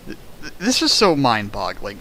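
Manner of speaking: ordinary talk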